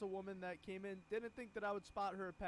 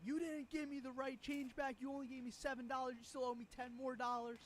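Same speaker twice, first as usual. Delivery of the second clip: imitating an angry customer; 7 semitones higher than usual. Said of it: "throws an absolute fit".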